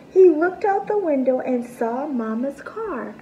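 plain reading voice

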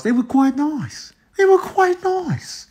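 high, sing-song relish; coy high-pitched approval; playful high pitch. Real, not acted. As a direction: lift it way up high and savor it. Savoring past dates.

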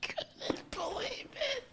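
high, slow, wheezing through tears of laughter; barely getting words out between laughs; real helpless laughter, cheeks aching; crying with laughter. Real, not acted. Crying with laughter after losing.

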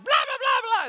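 very high, loud yammering; mock shrieking chatter. Preacher mimics the slave girl pestering Paul, mock shrieking. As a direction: imitate someone yelling nonsense way up high.

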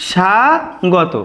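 স্বাগত stretched out very slowly as "sha-go-to"; slow model word. Shown as a demonstration. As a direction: draw out স্বাগত as "sha-go-to", falling. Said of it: "আমাদের স্বাগত উচ্চারণ কেমন?".

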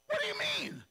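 very high loud disbelief; stunned groom demands explanation; squeaky shocked question. Retold or imitated. Jacob shocked.

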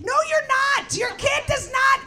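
high, loud comic rant; ranting shout. Acted. Comic yelling back at progressive parents, a loud rant. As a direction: shout them down.

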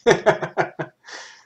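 a bright, high burst of laughter; delighted laugh. Real, not acted. Laughing at lighting effect.

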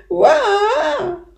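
long, high "waa" wail; echoed bawling. Acted. Echo answering the beaver across the lake, loud high wail. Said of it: "huilde de stem aan de overkant".

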